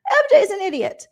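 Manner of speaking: high-pitched whiny fake crying; mock tearful wail. Recapper imitating upset housemate blaming her friend, mock tearful whine. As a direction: squeal it through pretend tears.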